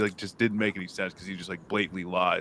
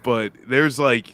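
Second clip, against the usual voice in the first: normal talking voice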